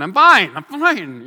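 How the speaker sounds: loud, drawn-out mock reassurance; insistent fake fine. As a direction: insist too hard that all is well.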